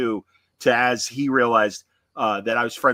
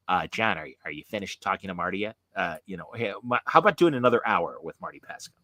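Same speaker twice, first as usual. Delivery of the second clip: lower, softer mimic with a little eh; imitating a friend who's tired of waiting, softer and lower; Mark impression; 6 semitones lower, 6 dB quieter than usual. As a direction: needle him, lower and softer, hesitant eh. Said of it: "a little eh in his voice".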